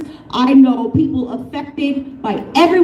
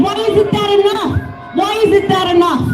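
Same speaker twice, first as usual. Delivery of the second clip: angry shout; 7 semitones higher than usual. Real, not acted. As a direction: hurl the question at the crowd. Angry rally speaker repeating question.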